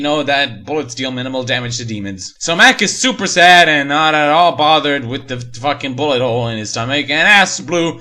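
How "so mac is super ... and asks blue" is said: usual speaking voice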